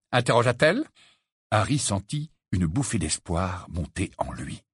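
plain reading voice

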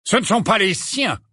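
high, scornful interruption; contemptuous Ban. A narrator's reading. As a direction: cut in with disdain. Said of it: "l'interrompit Ban avec mépris".

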